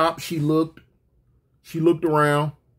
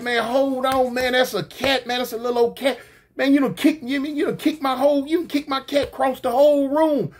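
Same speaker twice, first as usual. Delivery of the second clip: indignant protest; 7 semitones higher than usual. Retold or imitated. Acted quarrel over kicked cat.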